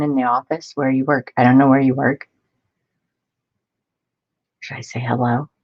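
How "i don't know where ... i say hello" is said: usual speaking voice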